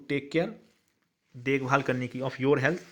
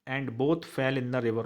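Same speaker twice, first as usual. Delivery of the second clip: slow dictation; much slower than usual. Shown as a demonstration. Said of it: "teacher dictates English answer".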